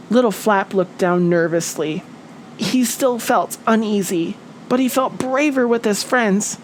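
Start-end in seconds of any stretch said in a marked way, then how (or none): none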